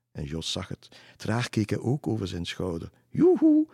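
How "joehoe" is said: loud, very high yoo-hoo; calling out. A narrator's reading. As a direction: hail someone across the river in a high singsong. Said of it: "riep de dame nog een keer". (luid en hoog roepen)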